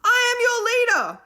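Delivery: high, loud leader's proclamation; proclaiming shout. A narrator's reading. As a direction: claim command of the room. Will proclaiming.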